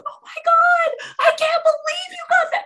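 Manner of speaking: very high, loud fangirl shriek; retold excited screaming. Fan shown a phone video of Paul, screaming. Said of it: "I started screaming".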